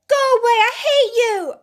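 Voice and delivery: high, loud furious scream at grandfather; child's shout. Acted. Boy screaming at his grandfather, furious shout. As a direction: yell it like a tantrum. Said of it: "Ann shouted".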